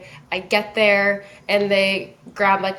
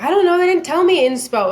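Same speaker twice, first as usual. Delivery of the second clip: high, louder clueless protest; flustered reply; 9 semitones higher, 5 dB louder than usual. Retold or imitated. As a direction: act out being caught without an answer. Flustered reply to makeup artist.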